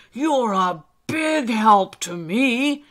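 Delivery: loud, slow, emphatic encouragement; hearty old elf. Acted. Old elf offers Alistair a special job, hearty. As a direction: boom it out to build the little one up.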